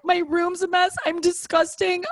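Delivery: high-pitched self-pitying lament; hammed-up self-loathing; mock despair. Confessing messy room to comforting sister, mock despair.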